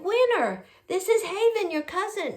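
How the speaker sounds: high-pitched, coaxing introduction; talking to a toddler. Retold or imitated. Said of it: "to the two-year-old".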